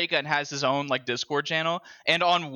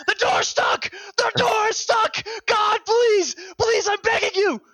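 very high, loud desperate shouts; panicked screaming; 4 dB louder, slower than usual. Retold or imitated. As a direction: scream and beg like you're trapped. Stuck player panicking, begging.

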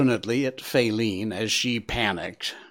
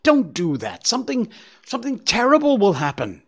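high, fast, frightened plea; panicked pleading; 10 semitones higher, 4 dB louder than usual. A narrator's reading. Faline begging Bambi not to go, panicked. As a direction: beg him in rising panic.

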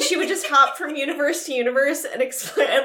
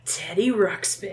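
slow, low dramatic reveal; ominous reveal; 7 semitones lower, 6 dB quieter, slower than usual. Acted. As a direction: drop the voice and draw it out. Naming the main evil villain.

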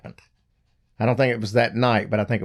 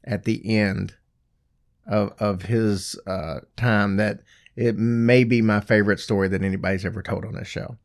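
normal talking voice

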